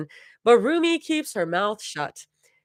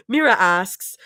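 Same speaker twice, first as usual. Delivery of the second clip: ordinary delivery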